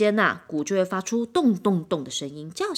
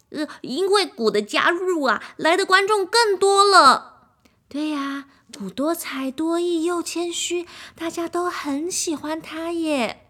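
higher, excited praise; cheerful orchestra members; 8 semitones higher, 3 dB louder than usual. A narrator's reading. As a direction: rave about the drum.

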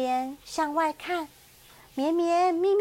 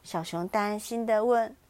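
ordinary delivery, nothing marked

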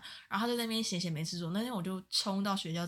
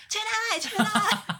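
much higher, louder, laughing demand; high shrill demand; 14 semitones higher, 9 dB louder, slower than usual. Retold or imitated. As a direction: squeal for the money, half laughing. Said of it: "用生态值的语气跟你讲话". (高八度边笑边要钱)

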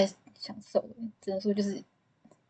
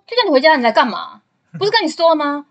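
higher, louder mock scolding of the girlfriend; imitating an angry boyfriend; 6 semitones higher, 8 dB louder than usual. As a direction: snap at her like an irritated boyfriend. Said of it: "就这么凶欸". (模仿男友不耐烦地大声训斥)

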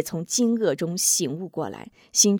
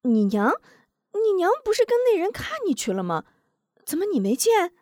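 high, fast astonished aunt; surprised; 11 semitones higher, faster than usual. A narrator's reading. Neighbor aunt amazed that Wu Zhong missed his mother, high fast. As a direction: rush it out amazed, pitched high. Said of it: "大婶惊奇道".

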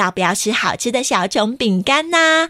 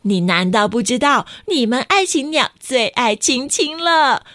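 startled mocking laughter; 5 semitones higher than usual. A narrator's reading. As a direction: cackle at the silly child. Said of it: "吓了一跳地说".

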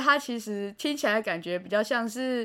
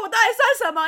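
mock wounded; 8 semitones higher, 7 dB louder, faster than usual. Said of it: "他就直接说".

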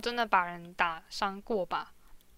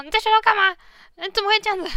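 high, loud scolding parent; mock angry parent; 8 semitones higher, 5 dB louder, much faster than usual. Parent scolds child over teacher's note, mock angry. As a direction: scold the kid like a fed-up parent. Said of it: "可能就会开始很生气啊".

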